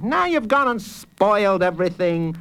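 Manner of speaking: high-pitched whiny complaint; moaning. A narrator's reading. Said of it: "moaned Noah".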